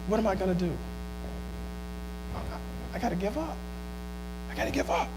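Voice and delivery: very soft, fading voice; acted helpless resignation. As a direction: play someone giving up, almost whispering. Voices someone helplessly giving up.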